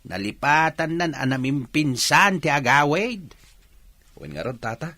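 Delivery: lightly voiced elderly father; old father. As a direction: play the fussy old father chatting.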